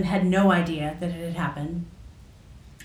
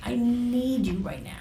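soft breathy whisper of need; hushed, private whisper; whispered plea; 3 semitones higher, 4 dB quieter than usual. Retold or imitated. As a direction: whisper it to yourself, almost no voice. Retold inner thought to her mom.

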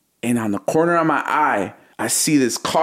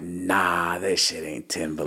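quiet disbelief; 6 semitones lower, 7 dB quieter, slower than usual. Retold or imitated. Disbelieving a famous follower.

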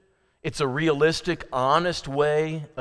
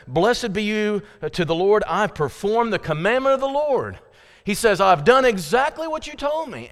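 Saul's smug boast, pitched up and louder; voicing Saul; 6 semitones higher, 4 dB louder than usual. Preacher voicing Saul's proud boast to Samuel, raised and loud. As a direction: play Saul proudly insisting he obeyed.

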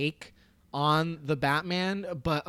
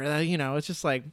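usual speaking voice

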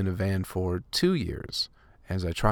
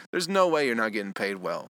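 normal talking voice

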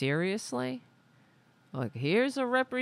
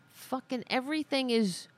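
ordinary delivery

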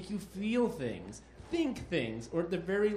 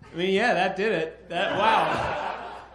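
laughing; 4 semitones higher, 7 dB louder, slower than usual. Real, not acted. Host reacting after the yelling.

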